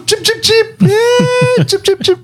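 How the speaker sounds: high chicken call through laughter; laughing chicken call. Retold or imitated. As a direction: call the hens while cracking up. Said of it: "She goes".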